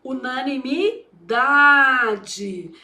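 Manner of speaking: "Unanimidade" very slowly, drawing out DA; syllable-by-syllable demonstration. Shown as a demonstration. Teacher showing where stresses fall, slow syllables in "Unanimidade". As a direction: spell out "Unanimidade" in long, separate syllables. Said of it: "é chamado de sílaba pré-tônica".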